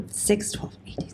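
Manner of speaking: whispered count under her breath; whispering. Real, not acted. Counting the months to herself in a whisper. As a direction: hush it, just counting to herself.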